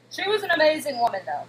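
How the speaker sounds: usual speaking voice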